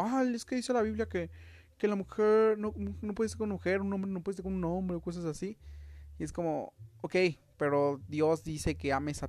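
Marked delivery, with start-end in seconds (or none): 0.0-5.0 higher, preachy mock voice of a Bible quoter; mocking quote. Mocking a religious person quoting the Bible, sneering. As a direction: parrot the churchgoer with a sneer. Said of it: "ahorita está diciendo como".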